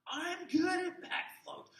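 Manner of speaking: put-on very high, slow little kid voice; a proud young kid; child bragging. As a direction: boast like a small child. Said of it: "Have you ever done a back float?".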